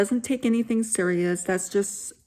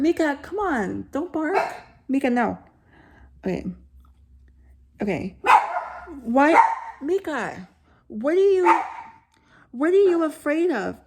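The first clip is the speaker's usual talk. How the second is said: higher-pitched coaxing at a pet; scolding the dog; 6 semitones higher than usual. Real, not acted. Reader breaking off to hush barking dog Mika, coaxing. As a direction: call the dog off, sharp then soothing.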